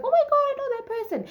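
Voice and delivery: high, fast squeal of recognition; excited surprise. Retold or imitated. Spotting an old school friend, high excited squeal. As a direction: gasp it out, thrilled to recognise him.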